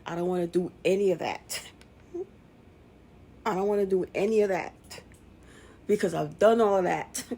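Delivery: loud, emphatic frustration; fed-up outburst. Real, not acted. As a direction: push every phrase out hard, repeating for force.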